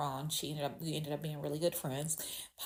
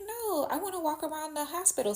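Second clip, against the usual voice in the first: put-on much higher, a small child saying no; little girl answering; 10 semitones higher than usual. Roommate girl wanting to roam the hospital, high child voice. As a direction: chirp it like a restless little girl.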